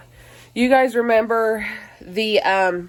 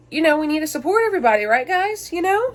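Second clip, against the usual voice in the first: excited; 8 semitones higher than usual. Real, not acted. Rallying viewers to support creators.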